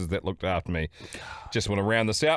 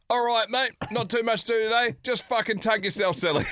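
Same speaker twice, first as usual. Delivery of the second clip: high, chirpy imitation of dad; mock dad voice; 12 semitones higher than usual. Teasing the son with a jokey dad voice. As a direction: put on a cheery dad's voice.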